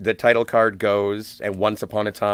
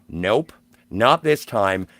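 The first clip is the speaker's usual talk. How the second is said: usual speaking voice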